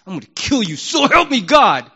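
loud, high exclamation of a furious oath; mock angry oath. Preacher mimicking a furious person swearing an oath, loud and high. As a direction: spit out the threat then swear it loud.

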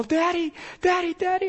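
put-on a little kid in trouble calling out; small boy calling. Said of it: "our boys, if they're in trouble".

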